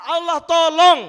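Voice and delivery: loud, rising burst on tolong; fervent preacher's cry; emphatic shout. Real, not acted. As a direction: proclaim it to the whole hall. Preacher exclaims to congregation.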